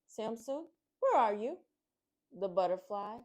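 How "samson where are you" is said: raised calling voice, searching. Acted. Rabbits calling for missing Samson, loud call. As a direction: call out into the distance for a lost friend. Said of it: "The rabbits called out".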